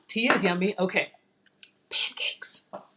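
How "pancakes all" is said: whispered word. Real, not acted. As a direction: breathe the word like a secret. Whispering the food name.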